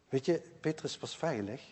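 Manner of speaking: normal talking voice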